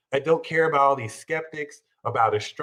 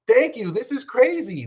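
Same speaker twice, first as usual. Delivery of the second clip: excited; 8 semitones higher, 3 dB louder than usual. Retold or imitated. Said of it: "now you can walk into".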